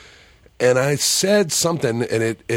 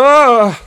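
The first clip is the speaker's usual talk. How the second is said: loud, high, drawn-out "aah"; mimicked yelp of alarm, swelling loud; imitated startled scream; 12 semitones higher, 11 dB louder than usual. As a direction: holler a long rising cry of fright. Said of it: "like that".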